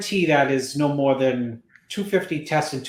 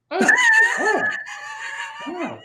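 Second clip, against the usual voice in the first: Bob's noises. Retold or imitated. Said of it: "Here's Bob".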